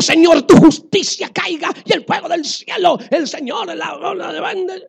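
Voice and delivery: loud, high, hammed-up call for heavenly fire; mock fire-and-brimstone prayer. Preacher joking about revenge, a loud hammed-up prayer. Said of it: "yo digo".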